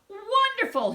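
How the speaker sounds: high, loud royal exclamation; the king bursts out in delight; delighted cry. Acted. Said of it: "he cried".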